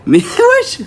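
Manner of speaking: very high, laughing outburst; laughing disbelief. Real, not acted. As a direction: burst out laughing mid-protest. (s'exclamer en riant, très aigu)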